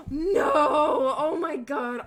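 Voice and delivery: loud dismayed no; shocked cry. Real, not acted. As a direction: gasp then cry out. Reacting to scar story.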